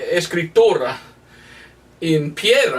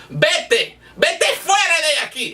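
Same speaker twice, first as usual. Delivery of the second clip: angry dismissal; 9 semitones higher than usual. Retold or imitated.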